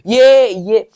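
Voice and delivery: a sudden loud burst. Shown as a demonstration. Teacher demonstrating a loud sound to the class. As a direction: punch it out loud to push the wave up. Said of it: "और तेज बोल दूं".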